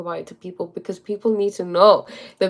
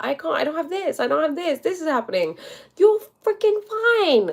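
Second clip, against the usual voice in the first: exasperated rant; 9 semitones higher than usual. Retold or imitated. Ranting at depressed boyfriend.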